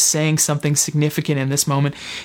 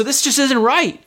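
higher-pitched, puzzled objection; mild protest; 9 semitones higher than usual. Retold or imitated. Student objecting to his rabbi serving him, mild protest. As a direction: object as if it plainly won't do. Voicing Peter's protest at role reversal.

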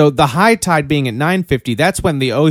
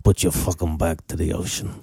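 grandpa voice; 4 dB louder than usual. Retold or imitated. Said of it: "All right, I'll do it again".

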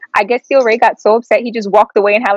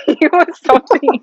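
laughing; 6 semitones higher, slower than usual. Real, not acted. Laughing repeat about salty dad.

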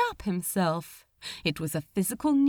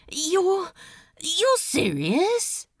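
higher, slow disbelieving question; stunned disbelief; 6 semitones higher, 4 dB louder, slower than usual. A narrator's reading. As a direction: ask as if you can't believe it. Crowley in disbelief.

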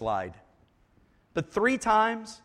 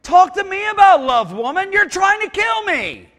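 indignant retort; 11 semitones higher, 11 dB louder than usual. Acted. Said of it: "I'd wanna say".

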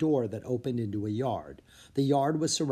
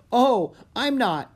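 surprised protest; 11 semitones higher, 7 dB louder, slower than usual. Acted. Sylvia protests loudly.

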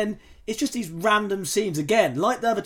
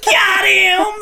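high shouted cheer, much louder; excited shout; 12 semitones higher, 9 dB louder than usual. Retold or imitated. Host acting out teens cheering on a movie villain, loud shout. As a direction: yell it out in triumph. Retelling kids' cheer in film.

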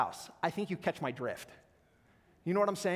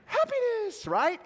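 "Happiness" sung out much higher, then falling; light happiness; 14 semitones higher, 4 dB louder, slower than usual. Shown as a demonstration. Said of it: "Say happiness".

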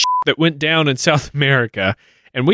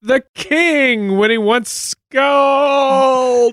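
drawn out; 11 semitones higher, much slower than usual. Pretend. Slow drawn-out joke.

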